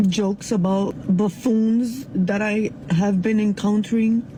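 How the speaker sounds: usual speaking voice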